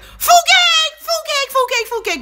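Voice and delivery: put-on high squeaky little-kid chant of fukeik; imitating a toddler shouting fukeik; child's mispronunciation. Mother imitating her little son's fruitcake chant, high and childish. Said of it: "My son couldn't say fruitcake".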